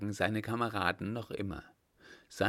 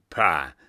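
scornful pah; 5 semitones lower, 11 dB louder than usual. Acted. Scoffing at danger.

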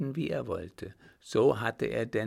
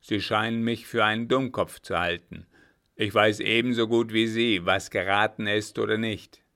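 louder, indignant officer; a louder, affronted captain snapping at the baron; offended captain; 8 dB louder than usual. Acted. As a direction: bristle at the insult.

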